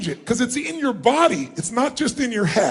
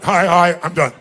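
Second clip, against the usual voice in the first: dismissive quick hello; 5 semitones lower, 4 dB louder than usual. Retold or imitated. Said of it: "you didn't go and you went".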